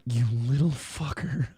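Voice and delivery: low-key grumble under the breath; quiet, exasperated mutter; muttered aside. Retold or imitated. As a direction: softly curse the cheeky kid to yourself. Muttered inner reaction to snickering son.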